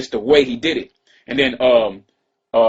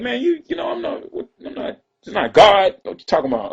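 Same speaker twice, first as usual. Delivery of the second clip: mock outraged rant; 9 semitones higher than usual. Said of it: "that's how they was acting".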